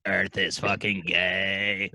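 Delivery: low, slow alien voice cracking into a laugh; deep, drawn-out alien sneer, laughing; alien Splurn. Pretend. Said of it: "his name's Splurn".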